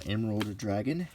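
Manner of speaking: ordinary talk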